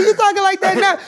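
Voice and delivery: high, loud retort through laughter; laughing comeback. Retold or imitated. Writer catches the comedian out, retold in a high laughing shout. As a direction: crack up while retelling the comeback.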